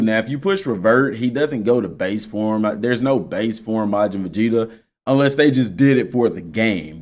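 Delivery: ordinary talk, nothing marked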